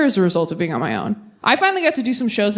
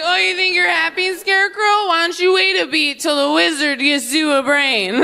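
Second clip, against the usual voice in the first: higher, sneering taunt; mocking; 7 semitones higher than usual. Heckler taunting the young bride, high and mocking. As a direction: taunt her like a scarecrow.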